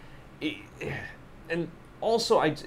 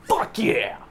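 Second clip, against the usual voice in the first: high excited whoop; excited cheer; 7 semitones higher, 3 dB louder than usual. Retold or imitated. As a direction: cheer it like opening night. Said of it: "was just like".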